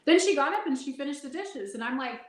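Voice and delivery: normal talking voice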